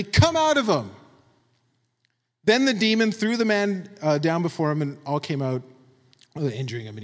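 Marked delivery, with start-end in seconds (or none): 0.0-0.9 high, stern order; firm, raised-pitch command. Retold or imitated. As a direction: cast it out with authority. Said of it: "Jesus said sternly".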